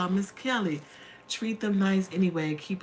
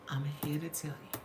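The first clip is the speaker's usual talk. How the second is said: earnest whisper; 4 semitones lower, 10 dB quieter than usual. Real, not acted. Emotional testimony to viewers.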